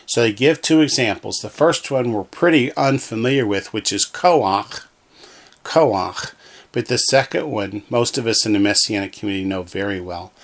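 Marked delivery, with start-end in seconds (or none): 4.0-4.8 "koach" slowly as KO-ach; ach ending. Shown as a demonstration. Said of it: "the combination is pronounced ach". 5.6-6.3 "koach" said KO-ach; ah before the guttural in "koach"; ach ending. Shown as a demonstration. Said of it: "the combination is pronounced ach".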